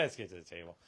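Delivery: soft, low aside; low-key repeat. Real, not acted. Host repeating the cue to move on, quietly.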